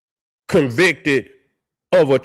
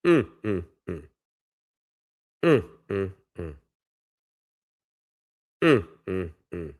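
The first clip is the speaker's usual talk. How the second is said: mournful hum; 9 semitones lower, 5 dB quieter than usual. Real, not acted. A man reacting to a wrongful conviction, humming low in sorrow. Grieving over the story.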